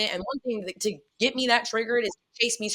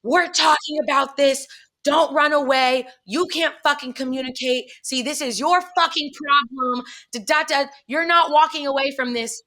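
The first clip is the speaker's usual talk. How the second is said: imitating a friend's higher-pitched hollering; raised, insistent mock rant; 5 semitones higher than usual. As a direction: mimic someone yelling after you down a hallway. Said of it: "she's now hollering out".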